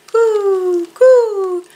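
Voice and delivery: high, long falling coos; cooing doves. A narrator's reading. Narrator voices doves near Owl, drawn-out high coos. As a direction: coo like a dove, letting each fall. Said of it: "The doves cooed".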